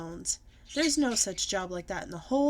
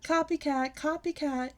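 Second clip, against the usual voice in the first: taunt; 7 semitones higher, 4 dB louder than usual. A narrator's reading. Bully taunting on bus.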